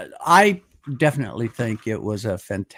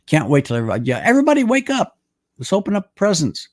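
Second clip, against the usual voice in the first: put-on excited kid; 8 semitones higher, 5 dB louder, faster than usual. Child eager Christmas morning.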